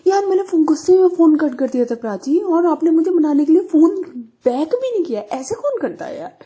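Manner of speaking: high, loud whiny complaint; upset complaint. Retold or imitated. Friend complains she never called back, loud whine. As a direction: pile on the hurt like a sulking friend.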